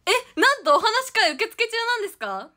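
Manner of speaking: high, loud amazed question; surprised. Real, not acted. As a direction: sound genuinely surprised at the news. Surprised reading comment. (驚いて高く大きな声で)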